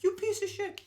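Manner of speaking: very high imitation of an angry customer; hammed-up, high-pitched tirade; mock cursing out. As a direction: hurl the insult in a put-on high voice. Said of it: "she's cussing me out".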